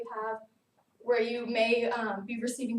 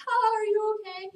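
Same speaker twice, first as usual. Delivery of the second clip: laughing teammates; 10 semitones higher, 5 dB louder than usual. Retold or imitated. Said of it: "and they're all laughing".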